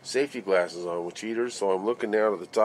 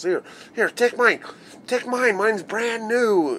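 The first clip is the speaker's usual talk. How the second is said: much higher and louder, eager and gushing; overjoyed offer; 13 semitones higher, 5 dB louder, slower than usual. Retold or imitated. Superintendent handing over his new hard hat, high and thrilled. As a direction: press the gift on him, thrilled.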